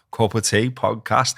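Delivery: ordinary delivery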